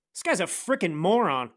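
ordinary talk, nothing marked

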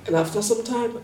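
normal talking voice

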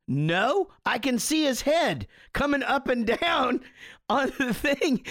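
high, giggling through the words; amused dad telling his wife he can see the kid; laughing reply. Retold or imitated. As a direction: chuckle while defending yourself.

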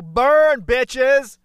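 high, shouted taunt; loud crowing. Pretend. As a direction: yell it like a victorious burn. Crowing over a tweeted jab.

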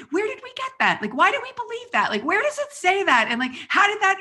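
high-pitched, insistent string of questions; worked-up, incredulous reenactment; retold frantic questioning. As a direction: pile up questions in mock exasperation. Retold late-night questioning of husband.

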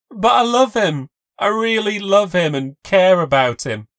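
high, loud desperate cry; tearful plea. A narrator's reading. Kayla pleading that she loves him, loud and tearful. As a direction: plead through tears.

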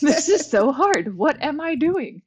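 high, loud mock whine; hammed-up exasperation; exasperated complaint. Re-enacting yoga struggle, laughing.

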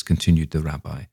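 usual speaking voice

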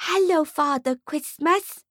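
slow, high, dreamy hello; lightly voiced swooning mother; breathless fan greeting Father Christmas. A narrator's reading. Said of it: "she swoons".

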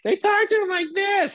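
very high, squeaky talking-down voice; mock how adults talk down to kids; exaggerated falsetto baby talk; high-pitched kid talk. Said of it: "high-pitched voice".

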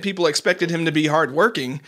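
ordinary talk

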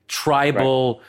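ordinary delivery

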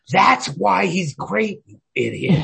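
slow, high and loud, smug and scornful; a bit tipsy, talking down to you; feisty grad student. Retold or imitated. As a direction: lecture him like a tipsy know-it-all grad student. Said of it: "graduate student who's, like, feisty".

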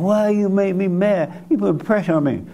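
a nagging wife's plaint, lightly hammed; mimicking a wife griping at her husband, half-hearted whine; whiny complaining wife.

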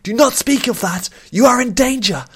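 high, loud, panicked plea; frantic warning. A narrator's reading. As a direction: cry out in terror to stop her. Queen panics, warns of danger.

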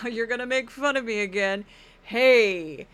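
mock-hurt Geraldo with a loud closing hey; lightly voiced, teased host protesting; Geraldo impression. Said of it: "I'm kinda getting the energy".

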